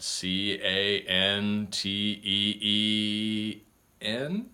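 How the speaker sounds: "C A N T E E N" drawn out slowly, final N swooping up; unsure questioning spelling. Retold or imitated. Pupil spelling canteen for teacher, slow and questioning. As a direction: spell it evenly and turn the last "N" into a question. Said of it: "saying it like they're asking a question".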